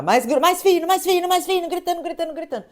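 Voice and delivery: high-pitched, relentless mock shouting; retold frantic yelling. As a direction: imitate a chef yelling the same orders nonstop. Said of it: "ele começou a gritar".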